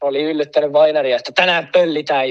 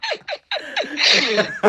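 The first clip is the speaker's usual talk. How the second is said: very high, soft burst of laughter; high, breathy laughing fit; big laugh; 12 semitones higher, 5 dB quieter than usual. Real, not acted. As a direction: laugh helplessly at the memory. Laughing after retold shout.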